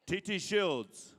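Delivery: high, slow name call; powwow emcee. Acted. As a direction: stretch the name for the arena. Calling names at a powwow.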